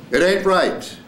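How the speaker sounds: high, drawn-up cry of a scene line; acted last line. Actor delivering the last line of the first scene, high and emphatic.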